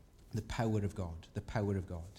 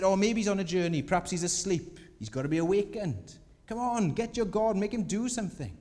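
high, loud teasing jibes; mocking taunt; 7 semitones higher, 5 dB louder than usual. Elijah teasing the prophets to wake their god, high mocking. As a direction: poke fun, egging them on. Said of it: "He's really taking the mick out of them".

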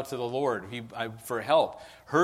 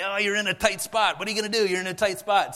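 higher, louder playful taunt; dad teasing his kids on the floor, playful and louder; teasing dad playing with kids; 6 semitones higher, 5 dB louder than usual. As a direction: tease the kids while tickling them. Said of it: "and I'd say".

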